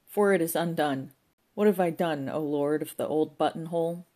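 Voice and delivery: loud, hammed-up lament to the Lord; theatrical wailing. A narrator's reading. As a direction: cry out to heaven in woe.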